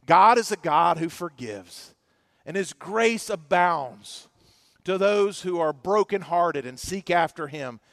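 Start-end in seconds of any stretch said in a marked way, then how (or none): none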